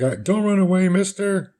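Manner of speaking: put-on 2 high child's voice calling after him; 3 plead with him not to leave; 4 a high, pleading boy's voice; 1 kid pleading. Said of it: "He's like".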